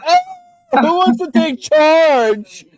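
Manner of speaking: very high, slow, dopey cop voice; mock clueless cop. Cop on a stakeout, unsure who leads, high and slow. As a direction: play a lost cop asking for a leader. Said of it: "they're just gonna be like".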